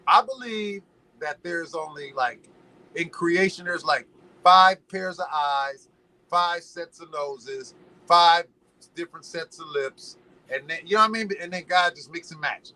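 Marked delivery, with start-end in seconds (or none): none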